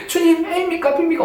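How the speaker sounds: put-on high, childish pleading; childlike prayer. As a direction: ask the Lord like a little kid. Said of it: "어린아이처럼 그냥 계속 기도하는 거예요". (아이처럼 높게 조르는 기도)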